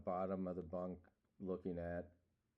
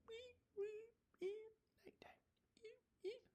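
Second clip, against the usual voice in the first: high whispered coyote yips; thin high wild-dog cries; coyote howl; 9 dB quieter than usual. Acted. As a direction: yip like a distant coyote. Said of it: "That was a coyote".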